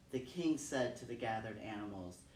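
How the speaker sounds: ordinary delivery, nothing marked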